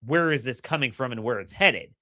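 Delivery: usual speaking voice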